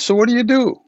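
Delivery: put-on high, curious old lama; lama quizzing the chant leader; Rinpoche's voice.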